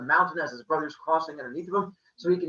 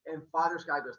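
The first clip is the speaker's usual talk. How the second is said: plain reading voice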